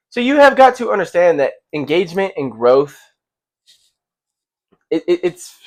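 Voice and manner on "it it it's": stumbling over the words; stuttering. Real, not acted. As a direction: stutter and restart. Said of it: "I'm stuttering bad".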